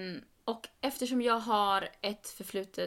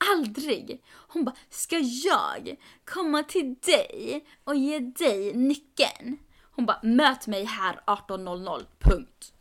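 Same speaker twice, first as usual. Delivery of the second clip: louder, emphatic imitation of a bossy friend; mock indignant demand; 3 semitones higher, 6 dB louder, slower than usual. Friend refusing to return the key, mock indignant. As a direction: hammer out each demand as the offended friend.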